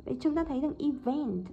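ordinary delivery

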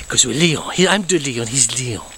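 ordinary delivery

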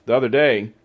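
ordinary delivery

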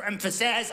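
"emphasis" wrongly stressed at the end, em-fa-SAS; stress on last syllable. Shown as a demonstration. French speaker unsure of English stress, saying "emphasis" as em-fa-SIS. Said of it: "Even in the word emphasis".

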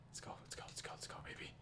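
rapid whispered self-urging; urgent whisper. Real, not acted. Player psyching self up, urgent whisper. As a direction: whisper it over and over like a chant.

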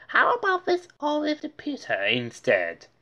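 high-pitched salesman offering an alternative; high, put-on sales voice; salesman's pitch. A narrator's reading. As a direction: pitch it way up, a salesman's hopeful suggestion. Salesman offers another item.

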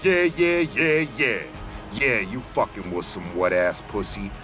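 ordinary delivery

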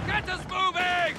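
urgent shouted order. Acted. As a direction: yell at the driver to go. Crew urges driver in combat.

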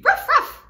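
high, loud little yaps; puppy barks. Shown as a demonstration. Teacher showing kids the puppy sound, high yapping barks. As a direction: bark like a tiny puppy. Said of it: "the puppies can be".

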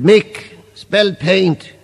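slow, drawn-out words; slow spelling request. Acted. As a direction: stretch each word out slowly. Asking to spell slowly.

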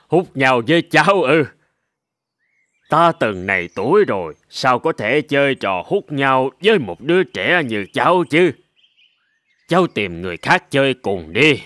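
put-on an old ram gently brushing off a child; old sheep. Old sheep turning down the goat kid's challenge, deep and amused.

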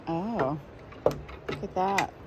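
ordinary talk, nothing marked

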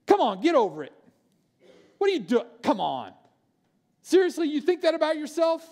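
imitating an incredulous brush-off; dismissive mock reply. People responding poorly to a cry for help, dismissive. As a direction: brush them off as if they are overreacting.

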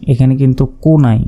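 ordinary delivery

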